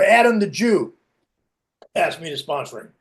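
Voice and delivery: ordinary talk, nothing marked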